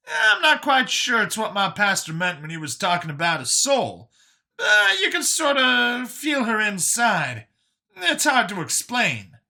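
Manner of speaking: high, animated explanation; Brian, earnest explainer. Acted. Brian explaining the clone to Vincent, high and earnest.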